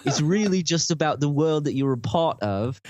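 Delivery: a lightly faked British accent, laughing through it; mock British accent. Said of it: "talk with a British accent".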